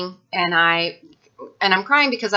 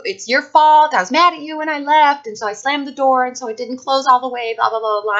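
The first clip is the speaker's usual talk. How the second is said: higher, louder mock yell of the boyfriend; retold shouting, pitched high and loud; imitated screaming; 7 semitones higher, 5 dB louder than usual. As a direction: replay his screaming fit, blaming her. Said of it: "He, he was screaming at me".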